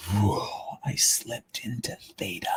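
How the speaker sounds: hushed, breathless whisper of wonder; whispering about slipping into theta, soft and awed. Retold or imitated. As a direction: whisper it as if afraid to break the spell. Describing slipping into deep meditation.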